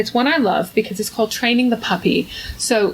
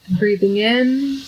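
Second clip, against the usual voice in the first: guided meditation. Acted. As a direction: ease listeners gently into their breath.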